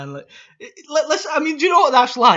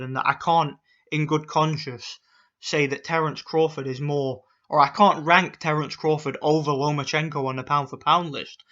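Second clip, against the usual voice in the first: usual speaking voice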